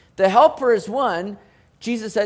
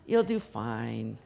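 mock soothing; 7 semitones lower, 5 dB quieter than usual. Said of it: "I'm not sitting here saying".

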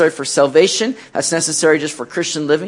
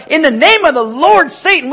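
mock rebuke; 8 semitones higher, 5 dB louder than usual. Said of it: "we throw this name of Jesus business out".

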